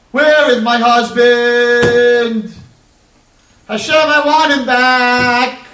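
high, loud and slow, a wailing plea; widow's wailing cry. Acted. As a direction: cry out for the lost husband, drawn out. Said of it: "she screams and she cries".

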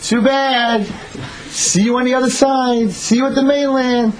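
high, stretched taunting holler; mocking scream. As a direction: holler down at him, singsong and gloating. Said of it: "he screams down".

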